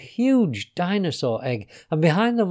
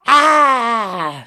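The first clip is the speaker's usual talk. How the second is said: high, loud drawn-out "aah!"; long falling storybook shriek; startled scream; 9 semitones higher, 9 dB louder than usual. A narrator's reading. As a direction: cry out in sudden shock. Witch cries out as she transforms.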